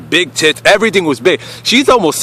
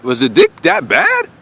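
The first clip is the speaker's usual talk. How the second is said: incredulous question. Retold or imitated. Wondering why she stopped calling.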